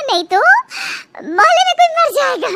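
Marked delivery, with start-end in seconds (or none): 1.3-2.5 fast, higher mock of the sister-in-law; quick high-pitched mimicry of Lily; mimicking Lily. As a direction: rattle it off as a cheeky imitation. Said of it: "ऐसे बोल रही है lily".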